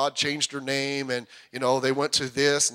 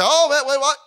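high, loud acted double take; mock startled listener; 9 semitones higher, 6 dB louder than usual. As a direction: blurt it out like a baffled listener.

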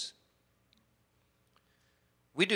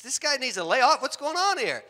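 higher and louder, working up outrage; mock indignant; 8 semitones higher, 3 dB louder than usual. Preachers pretending to be angry at the other car, mock indignation. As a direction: egg him on, fake indignant. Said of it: "and they say".

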